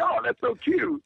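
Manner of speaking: high, slow gush; mock cooing. Imitating people who love the accent, mock cooing. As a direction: coo over it like a charmed outsider.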